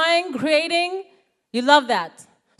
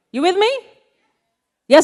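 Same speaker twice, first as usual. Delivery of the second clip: ordinary delivery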